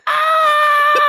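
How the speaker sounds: put-on very high, loud, sustained kid's wail; high-pitched wail. Little guy punched in the arm, high-pitched wail. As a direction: imitate a child's piercing scream. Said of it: "He lets out this high-pitched wail".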